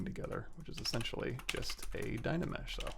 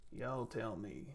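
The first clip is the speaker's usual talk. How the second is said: drawn out slowly and quietly; slow repeat; 8 dB quieter, much slower than usual. Real, not acted. Streamer asking chat again, slow and quiet.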